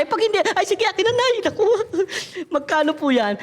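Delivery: put-on high, excited kid voice with a laugh; mock eager child taking the money. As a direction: grab the chance like a greedy kid.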